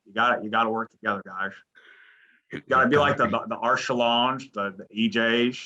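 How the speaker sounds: slurred coach pep talk, esses turned to sh; rally the fellas in a hammed-up coach voice; Dana Altman. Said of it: "that is a really good Dana Altman impression".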